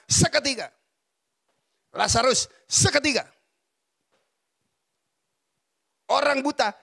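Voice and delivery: loud, high-pitched preaching, each seketika hammered home; full preaching. Acted. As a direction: preach it with rising fire, punching every seketika. Preaching on God's instant work. (khotbah berapi-api dengan suara keras dan tinggi)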